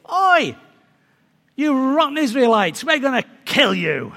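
loud, high shout across a valley; shouted challenge. Retold or imitated. Giant shouts across at the Israelite army, shouted challenge. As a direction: call out a mock threat at full voice. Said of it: "shout across and say".

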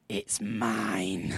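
put-on low, drawn-out claim as the child; daughter's possessive claim. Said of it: "And she said".

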